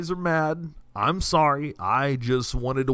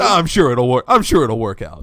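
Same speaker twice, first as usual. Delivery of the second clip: Stewie voice; 8 dB louder than usual. Retold or imitated. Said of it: "full Stewie".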